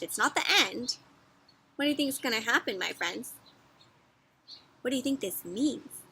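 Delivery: ordinary delivery, nothing marked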